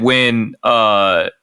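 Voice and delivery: normal talking voice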